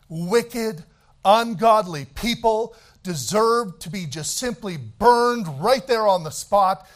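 preacher building to a climax; rising preacher fervor. Acted. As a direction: build the list to a thunder.

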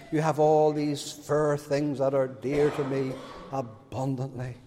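low, softened prayerful voice; devotional prayer. Real, not acted. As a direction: drop into a hushed, low address to the Lord.

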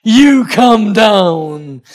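slow, drawn-out teasing order; playful command. Retold or imitated. Preacher teasing a girl to climb down, slow mock command. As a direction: stretch it out like a mock summons. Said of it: "having a little fun with her".

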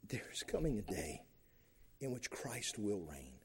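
whispered promise. Acted. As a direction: breathe it as a hushed promise. Hushed promise within the sermon.

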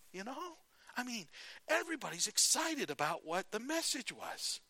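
Barney Fife voice. Retold or imitated. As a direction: coax him, pitch up high like a jittery deputy. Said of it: "let's just say it was Barney Fife".

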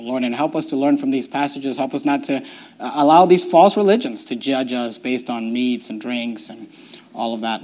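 hushed, fading prayer voice; closing prayer. Acted. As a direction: pray quietly over the church. Pastor leads closing prayer.

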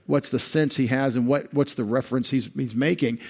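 ordinary talk

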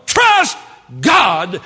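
high, loud preacher's climax; emphatic shout. Acted. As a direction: belt out the declaration of faith. Climax of acted defiant reply.

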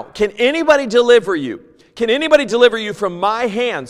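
the Assyrian commander shouting a taunt at Judah, loud and high; taunting Assyrian king. Acted. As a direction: bellow the boast like an enemy king. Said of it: "says the Assyrian king".

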